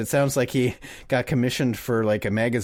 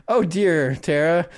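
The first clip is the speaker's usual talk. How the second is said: mock yell; 6 semitones higher than usual. Calling to Tara so the customer overhears, mock yell. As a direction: pretend to call to a colleague, meant to be overheard. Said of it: "yell into Tara and be like".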